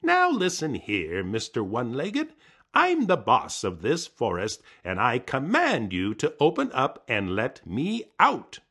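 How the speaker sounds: high, loud commanding voice; pompous trickster barking orders; bossy Coyote. Acted. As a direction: order the tree around like the boss. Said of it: "He talked to the tree".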